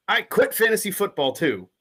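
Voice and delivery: hammed-up furious quitting; mock-furious fan storming off; angry reading. Shown as a demonstration. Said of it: "read it from an angry point of view".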